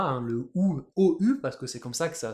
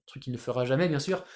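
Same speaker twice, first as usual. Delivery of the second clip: normal talking voice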